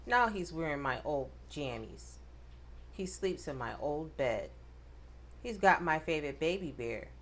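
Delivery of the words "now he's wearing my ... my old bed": usual speaking voice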